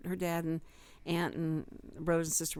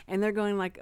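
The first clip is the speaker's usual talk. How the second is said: ordinary delivery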